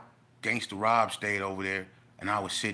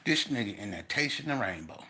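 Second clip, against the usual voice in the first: ordinary talk, nothing marked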